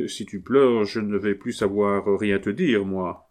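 ordinary delivery

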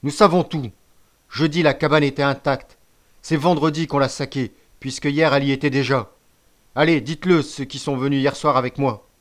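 loud, forceful indictment; stern accusation. A narrator's reading. Chief confronting the accused with proof, loud stern accusation.

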